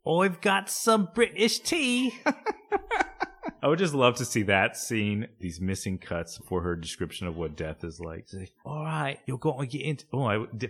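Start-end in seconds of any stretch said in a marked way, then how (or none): 0.1-2.1 high, slow British put-on voice; British accent. Joking about tea with co-host, high hammed-up British accent. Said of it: "forgot to do a British accent". 8.6-10.0 higher mock British voice; bad British accent. Acting out a missing scene, bad British accent. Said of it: "British accent is bad".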